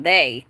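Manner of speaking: "They" set apart and punched; pointed emphasis on "They"; stress on They. Retold or imitated. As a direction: lean hard on the word "They". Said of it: "he wasn't talking about, like, that person".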